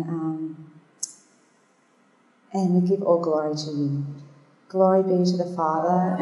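soft, even praying voice; prayer. Real, not acted. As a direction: pray gently and quietly, feeling for the words. Leads an opening prayer.

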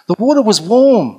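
high, loud retold exclamation; amazed insistence. Friend tells the speaker about the bath, amazed. Retelling friend's astonished report to her.

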